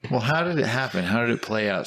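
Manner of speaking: ordinary talk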